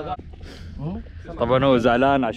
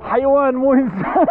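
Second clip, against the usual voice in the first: amazed; 14 semitones higher, 3 dB louder than usual. Real, not acted. Amazed at hand catch.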